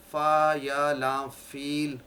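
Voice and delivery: فا یا لام فیل spelled letter by letter, very slowly; spelling it out. Shown as a demonstration. Teacher spells the word فیل slowly for students. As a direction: sound out فا, یا, لام, then فیل, drawn out. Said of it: "اگر میں فیل پروناؤنس کرتا ہوں".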